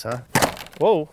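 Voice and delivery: ordinary talk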